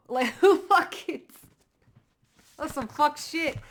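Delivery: high, loud laugh into a scoff. Real, not acted. Reader scoffing at jealous people, laughing high. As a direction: cackle then spit it out in disbelief.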